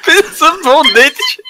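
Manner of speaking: laughing. Real, not acted. Laughing repeat.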